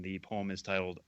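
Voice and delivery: ordinary talk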